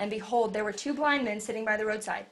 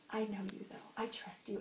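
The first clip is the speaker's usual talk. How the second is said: soft, much quieter, intimate assurance; quiet trust; 13 dB quieter than usual. Pretend. Mary quietly telling Jesus she trusts him, soft. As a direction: murmur it like a trusting mother.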